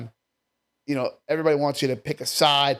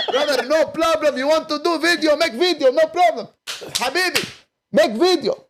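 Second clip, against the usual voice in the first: put-on higher, Arabic-accented halal cart guy; 12 semitones higher, 5 dB louder than usual. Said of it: "He's like".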